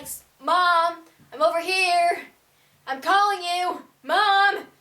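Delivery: high, loud repeated call; insistent shout across the park. Retold or imitated. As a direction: yell to get mom's attention. Calling mom again, louder.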